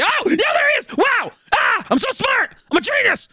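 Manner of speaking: high excited yelling; triumphant high shout. Real, not acted. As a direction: shout with glee, pitch up high. Excited boss spawn.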